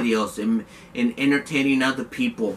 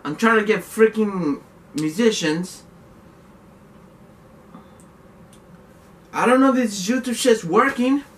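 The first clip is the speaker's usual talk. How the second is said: much higher, louder exasperated venting; real frustration boiling over; frustrated rant; 10 semitones higher, 5 dB louder than usual. Real, not acted. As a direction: pitch climbing in real frustration. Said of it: "This is frustrating".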